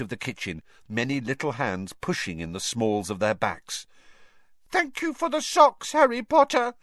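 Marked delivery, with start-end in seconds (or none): 4.7-6.7 high, squeaky calling voice; miserable elf call. A narrator's reading. Dobby calls thanks from the hearth, miserably. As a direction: call it out as a sorry little elf. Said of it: "Dobby called miserably".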